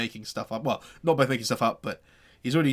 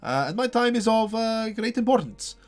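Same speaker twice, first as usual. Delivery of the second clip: pompous burgomaster; 7 semitones higher, 7 dB louder than usual. Acted. Burgomaster pronounces judgment.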